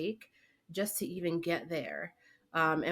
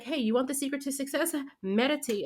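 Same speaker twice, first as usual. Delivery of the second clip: mock hype pitch; 8 semitones higher, 3 dB louder than usual. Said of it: "They're just like".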